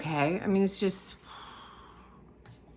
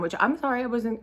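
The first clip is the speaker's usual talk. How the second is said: normal talking voice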